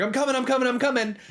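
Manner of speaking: high, hurried repeated call; mock plea to hold the elevator; imitated urgent call. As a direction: call out as if running to catch up. Imitating player running for the elevator.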